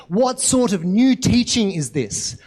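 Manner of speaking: normal talking voice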